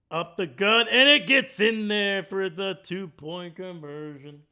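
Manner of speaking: loud, thrilled call of the score; excited play call. Real, not acted. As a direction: shout it out as the play breaks. Big two-point play, excited call.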